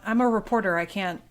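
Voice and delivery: ordinary talk, nothing marked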